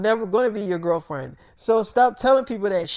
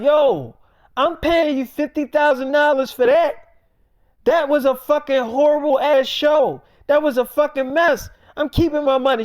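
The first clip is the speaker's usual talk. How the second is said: higher, louder furious club promoter; angry promoter; 6 semitones higher, 4 dB louder than usual. Retold or imitated. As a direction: chew her out like a ripped-off promoter. Angry promoter scolding [name].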